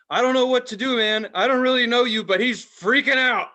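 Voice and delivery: high, loud worried voice; worried caller. Retold or imitated. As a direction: act out the caller panicking on the phone. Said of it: "was like".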